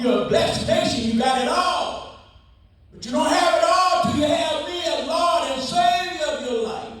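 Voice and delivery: shouting God's warning to America; preaching shout. Acted. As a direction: thunder it from the pulpit. Sermon peak, shouted.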